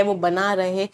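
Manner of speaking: normal talking voice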